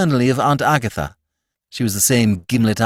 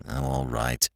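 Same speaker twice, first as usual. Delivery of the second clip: grudging; 3 semitones higher, 9 dB quieter than usual. Acted. Corky to Bertie, grudgingly agreeing to bring Jeeves. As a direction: concede it without warmth. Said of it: "Not cordially, but he said it".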